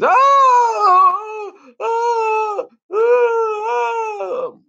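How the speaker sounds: high, drawn-out comic wailing; hammed-up frightened cries, sustained and high; mock scared wail. Reviewer mocking the scout who is scared of everything, mock terrified wail. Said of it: "he's scared of everything".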